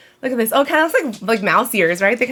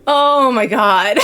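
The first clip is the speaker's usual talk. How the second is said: slow "Oh my God" into a high laugh; real laughter at a bad pun; laughing groan; 5 semitones higher, much slower than usual. Real, not acted. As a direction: groan then crack up. Reacting to the pun.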